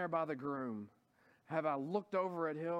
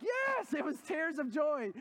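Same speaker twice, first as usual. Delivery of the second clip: higher, louder burst on the yes; emphatic yes; 9 semitones higher, 3 dB louder, slower than usual. Retold or imitated. Pastor insisting to his wife he wanted to marry, emphatic shout. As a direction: blurt it out to insist. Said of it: "I was like".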